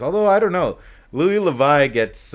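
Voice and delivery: sudden excited aside. Real, not acted.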